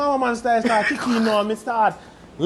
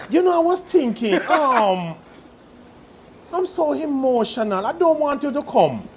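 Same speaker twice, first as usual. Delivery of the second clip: higher, mock-emotional English girlfriend; English accent, higher and hesitant, slowing on the excuse; imitated English accent; 4 semitones higher, 3 dB louder than usual. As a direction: imitate an English woman gently letting someone down. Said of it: "that England woman".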